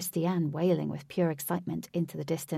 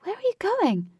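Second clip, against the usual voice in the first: high, loud panicked question; 14 semitones higher, 6 dB louder than usual. A narrator's reading. Katie to Huey as he rides off, panicked. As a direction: voice leaping in alarm. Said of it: "Katie panicked and said".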